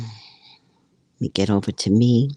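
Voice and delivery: low, slow demonic voice; deep glitchy demon tone. Real, not acted. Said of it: "the demonic voice is back".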